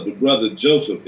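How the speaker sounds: normal talking voice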